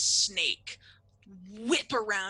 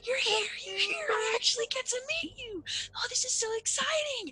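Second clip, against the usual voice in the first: put-on very high, gushing kid voice; squeaky-high childlike excitement; excited little boy. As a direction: burst out as an overjoyed child. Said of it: "and just like".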